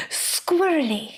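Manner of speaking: SQUIRRELY stretched slowly, every sound pronounced; over-enunciated. Retold or imitated. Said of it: "really enunciated every letter in the word".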